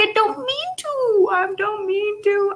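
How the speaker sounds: high, slow whiny protest; hammed-up helpless apology; mock pleading. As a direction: whine it like a kid caught out. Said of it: "I'm like".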